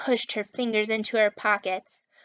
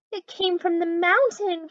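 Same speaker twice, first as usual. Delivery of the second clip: higher, slightly louder anxious cry; frightened cry; 8 semitones higher, 4 dB louder than usual. Acted. Mitzi frightened by the moan, crying out mildly. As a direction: cry out a little scared. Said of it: "cried Mitzi".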